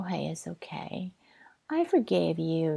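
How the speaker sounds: usual speaking voice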